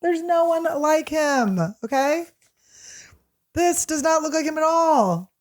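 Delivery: high, drawn-out mock indignation; indignant insistence. Retold inner protest about the dog, mock indignant.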